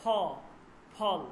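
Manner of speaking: "ফল" with a p given a little push of air. Shown as a demonstration. Teacher explaining the push on p, "ফল" with aspirated ph. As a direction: burst into "ফল" on an aspirated ph. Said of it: "হবে ফ".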